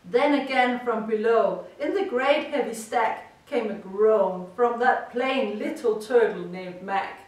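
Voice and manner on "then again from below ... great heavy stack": usual speaking voice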